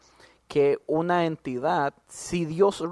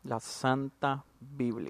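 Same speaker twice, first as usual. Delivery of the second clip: slow, low-key reverent naming; hushed, drawn-out pronouncement; mock solemn; 3 semitones lower, 7 dB quieter, slower than usual. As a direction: say it softly with exaggerated holiness. Mock reverence for the holy book.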